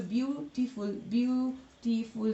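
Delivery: each beautiful slowed into view, टी, फुल; syllable by syllable. Shown as a demonstration. Teacher recalling the three syllables, slowly syllable by syllable. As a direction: sound out beautiful in three beats. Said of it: "जो तीन मैंने बोला syllable से".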